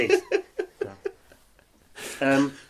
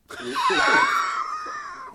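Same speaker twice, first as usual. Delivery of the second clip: shrieking laugh. Real, not acted. Big laugh.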